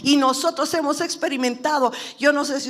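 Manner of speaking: voz alta y aguda de predicador; prédica enérgica. Real, not acted. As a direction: proclama con fuerza quién es Dios. Predica con fuerza. (predicando con fervor, a plena voz)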